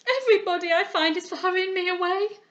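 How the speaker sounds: high, loud tearful complaint; sobbing protest. A narrator's reading. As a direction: protest through sobs. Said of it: "cried the sobbing Henrietta".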